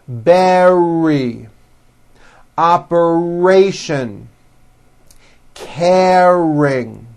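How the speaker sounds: slow words, each stretched on the R inside; strong middle R. Shown as a demonstration. Teacher says repeat after me, middle R drawn out and strong. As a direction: hold the R long in words like "arrange", "correct", "hurry". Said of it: "practice words with R in the middle".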